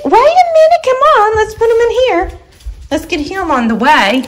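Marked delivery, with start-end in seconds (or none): 0.0-0.8 much higher sing-song coaxing to the dog; high, coaxing voice for a pet. Real, not acted. 0.8-2.3 much higher, sing-song coaxing; coaxing the dog, high pet voice. Real, not acted.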